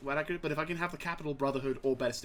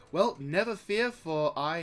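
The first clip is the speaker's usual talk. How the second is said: slow, higher proclamation; mock heroic; 6 semitones higher, 6 dB louder, slower than usual. Gamer reassuring viewers in a hammy heroic voice. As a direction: declare it like a hammy hero.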